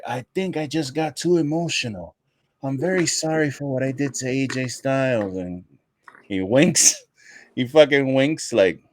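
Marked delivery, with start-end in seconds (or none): 0.1-5.3 higher, put-on contrite wrestler; mock apology. Wrestler answering the backstage interviewer, mock apology. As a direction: feign being very sorry, higher pitched. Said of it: "And he's like".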